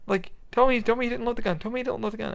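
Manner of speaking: high-pitched imitation of a panicking girlfriend; high, mock-anxious girlfriend voice; girlfriend freaking out. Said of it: "my girlfriend's like".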